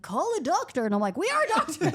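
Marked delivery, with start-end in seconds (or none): 0.0-0.8 imitating worried parents, higher and louder; loud, high demand, as retold. Parents to young doctors at bedside, demanding. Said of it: "the parents are there and they're like". 1.2-1.9 high, loud mock protest; hammed-up indignant comeback, pitched way up; indignant retort. Young doctor answering doubting parents, indignant.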